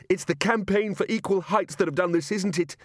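high-pitched, indignant accusing shout. Acted. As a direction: cry out the accusation, beside yourself. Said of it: "he shouted".

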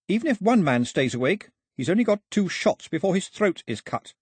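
put-on high, insistent girl's voice; high-pitched, firm negotiating; Daphne, bargaining. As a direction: press the offer on the gunmen.

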